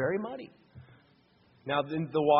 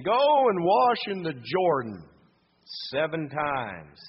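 commanding call; 8 dB louder, slower than usual. Acted. Messenger's command, slow and loud.

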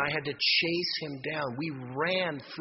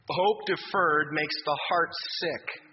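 solemn scripture reading; much slower than usual. Acted.

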